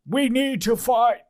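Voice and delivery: high, slow battle cry from a bear; polar bear. Acted. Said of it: "a polar bear who is just walking, going".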